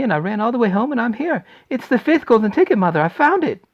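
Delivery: loud, high, tumbling words; breathless excitement. Acted. Charlie telling his mother about the ticket, loud high tumbling excitement. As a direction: spill it all out at once.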